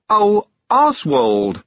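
slow, delighted and tender. Acted. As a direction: greet a returned friend with joy. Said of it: "her face one huge smile".

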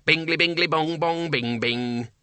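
sing-song imp clock chime; chiming demon. A narrator's reading. Watch demon sounding the hour, chiming. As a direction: chant it high like a little alarm.

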